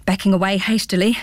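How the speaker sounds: ordinary delivery, nothing marked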